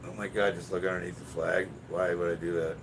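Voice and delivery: normal talking voice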